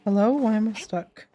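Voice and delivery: normal talking voice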